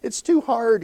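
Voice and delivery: imitating a high-pitched, whiny hiker; whining complaint. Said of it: "she started whining even".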